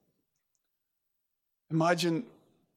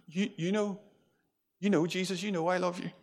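humbled; 3 semitones higher than usual. Retold or imitated. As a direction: stammer it out, chastened and unsure. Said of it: "Peter's not so, he's not so cocksure anymore".

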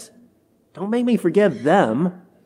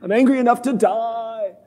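hammed-up sulky Jonah, a bit higher; drama queen Jonah; 4 semitones higher than usual. Preacher replaying Jonah as a drama queen. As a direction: overplay the wounded pride. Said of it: "hear Jonah as a little bit of a drama queen".

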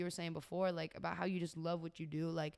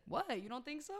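higher, lightly imitated disbelief; mock surprise; 7 semitones higher, 5 dB quieter than usual. CEO surprised the mom laughs, mild mock disbelief. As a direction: act a bit thrown by the laugh.

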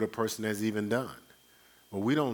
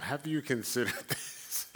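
laughing question; 4 semitones higher, 4 dB quieter than usual. Shown as a demonstration. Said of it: "So let me ask you something".

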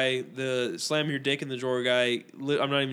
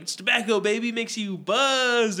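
higher voice, slowing and stretching the last words; retold hype; 6 semitones higher, slower than usual. Running mate selling chewing tobacco to a newbie, hyped. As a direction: imitate a cocky kid hyping it up, drawn out.

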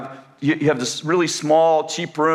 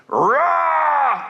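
mock roar; 12 semitones higher than usual. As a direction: pounce with a kid's roar. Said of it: "And Elijah goes".

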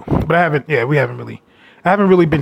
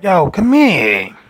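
slow smooth come-on; 2 semitones higher, much slower than usual. Retold or imitated. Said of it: "And I was like".

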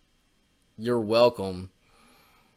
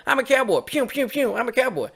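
much higher, hammed-up cowboy voice; mock cowboy Bush; 11 semitones higher than usual. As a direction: play a silly cowboy with toy gun sounds. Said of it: "he's the only one that's like".

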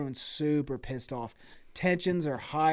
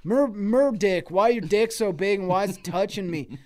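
loud, raised mock yell; pissed-off yelling; 4 semitones higher, 6 dB louder than usual. Angry customer yelling at Mer Dick about touching, mock-angry yell. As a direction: yell an exasperated complaint, hammed up. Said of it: "everyone just getting pissed and yelling".

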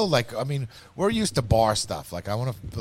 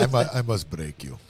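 mock Russian accent. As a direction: drop the pitch for a joke Russian accent. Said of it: "Rocky Four".

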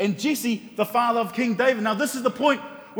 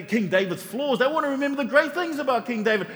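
usual speaking voice

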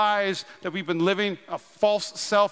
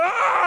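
high rising mock yell; acted throwing yell; 13 semitones higher than usual. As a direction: re-enact a furious heave. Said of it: "And so I went".

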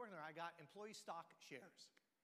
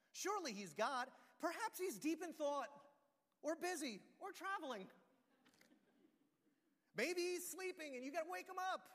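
high, loud mock concern; taunting; 9 semitones higher, 7 dB louder than usual. Elijah mocking the prophets of Baal, high and loud. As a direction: needle them, sarcastic. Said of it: "Elijah began to taunt them".

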